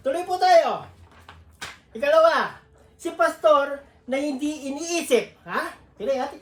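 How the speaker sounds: ordinary talk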